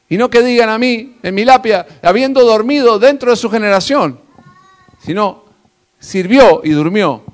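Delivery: loud, firm declaration; preacher contrasting epitaphs, loud and firm; emphatic preaching. Acted. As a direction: punch the epitaph home.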